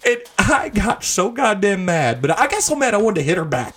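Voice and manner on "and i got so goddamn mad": ordinary talk, nothing marked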